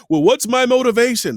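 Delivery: high, loud, hammed-up actor asking his motivation; old-time movie actor. Mocking the old-movie actor to a fellow voice actor, high and loud. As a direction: play the fussy actor begging for a reason. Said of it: "the actor's like".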